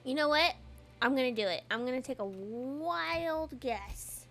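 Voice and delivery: normal talking voice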